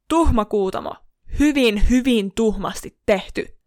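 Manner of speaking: loud scolding, as if to a misbehaving pet; lemmikin toruminen. A narrator's reading. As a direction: scold a naughty pet loudly and emphatically. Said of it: "Hän torui ystävänsä kuin huonosti käyttäytynyttä lemmikkieläintä". (torua kovaan ääneen kuin tuhmaa lemmikkiä)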